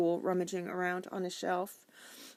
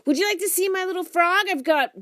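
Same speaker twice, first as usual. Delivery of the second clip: put-on high, loud excited boy; eager kid voice; 13 semitones higher, 9 dB louder than usual. A narrator's reading. Boy interrupts.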